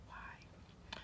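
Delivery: breathy whisper; disbelieving whisper; whispered why. Retold or imitated. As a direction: mouth it in disbelief.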